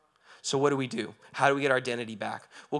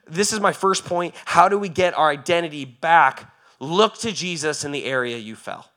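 louder, rising preaching; building preaching; 5 semitones higher, 11 dB louder than usual. Acted. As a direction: drive it home, getting louder.

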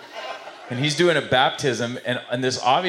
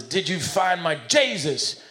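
slow Southern preacher, pitched up; higher, drawn-out Southern accent; 5 semitones higher, slower than usual. Acted. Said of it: "he's kinda Southern".

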